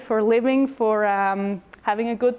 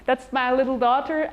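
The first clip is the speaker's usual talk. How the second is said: ordinary talk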